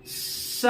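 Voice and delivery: स as a long voiceless hiss; a breathy sibilant स demo. Shown as a demonstration. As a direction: let स hiss out softly between the teeth. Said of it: "शुरू में एक सिसकारी निकल गई".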